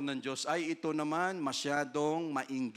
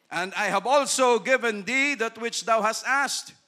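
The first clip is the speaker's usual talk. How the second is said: higher, louder God's promise; God promising more, loud; scripture reading; 5 semitones higher, 8 dB louder than usual. Acted. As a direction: proclaim the gift. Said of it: "quotes scripture".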